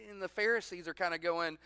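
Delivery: usual speaking voice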